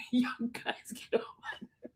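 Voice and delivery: faint, voiceless wheeze of laughter; laughed out of breath. Real, not acted. Host worn out by teasing viewers, laughed out of breath. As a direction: squeeze the words out breathlessly, barely audible. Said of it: "I can't stop laughing".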